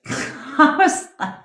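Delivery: high laugh through the words; breaking into laughter. Real, not acted. Laughing mid-story.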